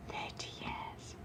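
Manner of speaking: hushed secret whisper of the number. Acted. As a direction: breathe it like a confession nobody must overhear. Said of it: "Don't know why I'm whispering that".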